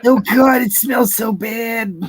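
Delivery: high, slow grossed out; disgusted shout. Acted. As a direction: yell as if gagging on the smell. Acting out the smell.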